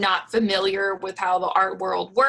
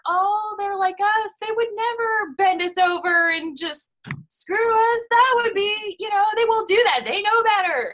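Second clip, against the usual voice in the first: much higher, wide-eyed trusting voice; naive gullible voice; 12 semitones higher than usual. Retold or imitated. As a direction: play the starry-eyed fool who trusts them. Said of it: "And you're like".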